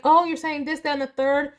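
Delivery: put-on like a parent finally cracking her toddler's words; excited realization. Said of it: "And I was like".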